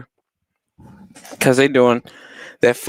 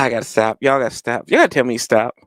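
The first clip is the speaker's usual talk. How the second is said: usual speaking voice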